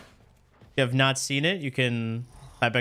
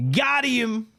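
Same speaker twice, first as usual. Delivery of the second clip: excited; 11 semitones higher than usual. Real, not acted. Wins a fight in the game.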